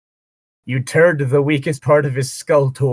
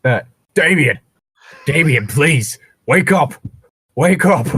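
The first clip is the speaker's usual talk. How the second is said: building from pleading to a louder, higher begging; desperate pleading; 3 semitones higher, 5 dB louder than usual. Acted. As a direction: call him back, more frantic each time. Player begs fallen companion to wake.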